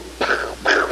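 mouth-made shooting noise; voiceless bursts of pretend gunfire; imitated gunshots. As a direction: puff out cowboy gunshots. Mimics gunfire of TV cowboys.

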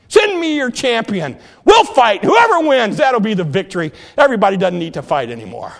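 loud, high, taunting call across the field; giant's loud boastful challenge; booming giant challenge. Acted. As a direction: bellow it out like a giant daring the army. Goliath taunts the army.

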